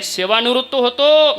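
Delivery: firm declaration. Acted. Sena declares leaving service.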